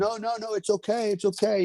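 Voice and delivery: a hammed-up high reassuring voice; coaxing stable hand. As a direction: sweet-talk him onto the horse in a put-on high voice. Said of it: "He goes".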